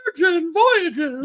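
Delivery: squeaky, very high and slow; helium voice. Shown as a demonstration. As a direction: say Virgin Voyages like you sucked helium. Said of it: "Why am I talking like I'm on helium?".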